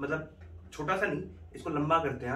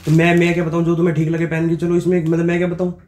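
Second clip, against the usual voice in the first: much louder, fed-up; faked irritated shout; 4 semitones higher, 10 dB louder than usual. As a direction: brush her off as part of the prank. Faked quarrel.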